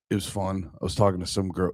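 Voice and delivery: ordinary talk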